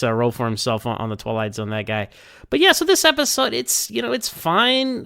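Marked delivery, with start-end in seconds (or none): 2.5-5.0 high, mock-bright shrug of a voice; damn with faint praise, pitched way up; meh verdict. Real, not acted. Host giving the episode a lukewarm verdict, high and mock-cheery.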